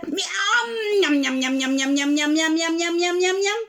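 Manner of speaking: mock gobbling noises; hammed-up chomping; munching sounds. Fox gobbles the pancake up, mock munching.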